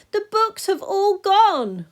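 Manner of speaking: high, loud anguished cry; cried out. Acted. Peter crying out to the class about the missing books. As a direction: wail it in dismay. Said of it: "cried out Peter".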